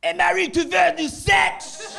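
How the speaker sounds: loud, slow, crude Quebecois French shout; Quebecois French accent. Acted. Comic contrasts Quebec with Paris French, shouted Quebecois accent. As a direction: holler it in rough Quebecois French. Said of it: "Quebecois French sounds more like this".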